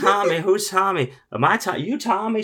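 high, loud hammed-up drunk woman. Drunk woman accosting the desk clerk, high and loud. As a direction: play the tipsy stranger barging in.

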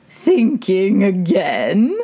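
lightly voiced old duchess; a hint of a fussy old duchess; frail withering duchess. A narrator's reading.

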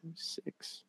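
counting in a whisper. Real, not acted. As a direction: count under your breath to yourself. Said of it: "counting the films under breath".

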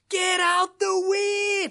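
put-on very high, drawn-out accented scream; shepherd's scream. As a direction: imitate a screaming country shepherd. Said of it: "he started to scream at me".

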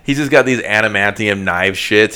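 ordinary delivery

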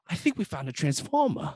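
mock Mark Wahlberg in a Boston accent; lightly put-on Boston accent, dropping the final r. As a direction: play it broad Boston. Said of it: "He's Boston in every movie".

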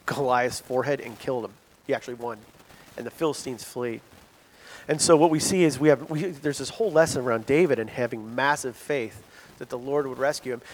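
ordinary talk, nothing marked

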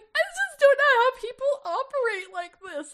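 very high, exaggerated mimicry of a sobbing woman; mock distraught wail. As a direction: wail it way up high, making fun of her. Mocking crying Jess, then own retort.

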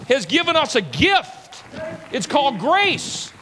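climbing sermon cry; full-force preaching. Acted. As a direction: preach it out, rising hard.

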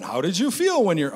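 loud, high-pitched incredulous question; mock insensitive question. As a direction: blurt it as an appalling thing to ask.

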